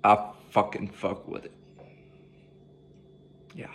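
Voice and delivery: normal talking voice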